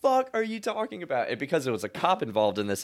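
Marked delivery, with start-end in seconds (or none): 0.0-1.3 high-pitched indignant retelling; indignant and high, half amused; incredulous outburst. Retold or imitated. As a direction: act out outraged disbelief at the accusation. Retold outburst at the cop.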